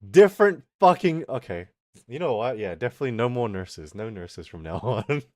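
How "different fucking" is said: sudden loud burst of swearing; loud outburst. Real, not acted. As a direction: blurt it out loud and exasperated. Sudden outburst mid-story.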